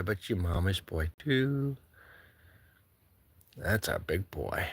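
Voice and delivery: low, soft cooing to the cat; tender pet talk, low and soft. Real, not acted. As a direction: croon to your pet.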